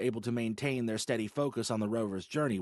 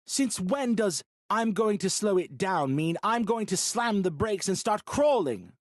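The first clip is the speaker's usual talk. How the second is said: high, loud, slow scolding; angry scolding; 11 semitones higher, 6 dB louder, slower than usual. Acted. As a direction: throw her words back angrily. Bo scolds mocking her words.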